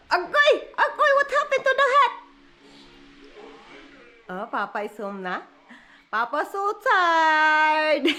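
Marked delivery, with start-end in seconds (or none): 0.1-2.1 high, loud playful cry. Real, not acted. Mother playing with the baby in high loud baby talk. 6.2-8.1 slow, stretched, laughing; drawn out. Real, not acted. Wife teasing about tired husband, slow and stretched.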